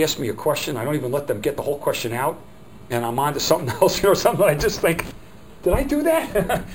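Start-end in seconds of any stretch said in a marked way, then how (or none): 5.6-6.6 much higher, slow sheepish voice; mock dismay. Trainer mocking himself hearing his recording, much higher and slow. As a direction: play the caught-out goof.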